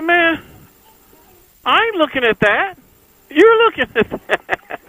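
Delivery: high, loud mock-indignant comeback; incredulous retort, pitched high. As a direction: throw it back at him, sky-high and amused. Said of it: "I said".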